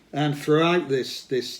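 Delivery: ordinary delivery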